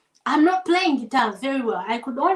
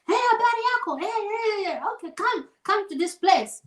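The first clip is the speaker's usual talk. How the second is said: higher, bright chatty phone voice; Matias on the phone; 7 semitones higher than usual. Retold or imitated. Matias directing the driver over the phone, chatty. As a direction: give directions cheerfully in Swahili. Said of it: "Matias speaks very good Swahili".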